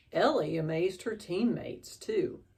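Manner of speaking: plain reading voice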